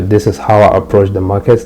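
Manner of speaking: ordinary talk, nothing marked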